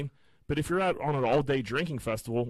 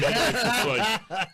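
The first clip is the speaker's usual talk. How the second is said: laughing; 9 semitones higher, 5 dB louder, much slower than usual. Real, not acted. Laughing at himself.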